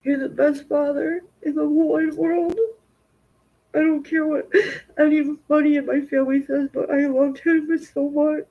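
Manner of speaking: high and tearful, voice breaking; a daughter crying over her father's death. Real, not acted. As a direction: sob it out through real tears. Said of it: "the tears are coming down".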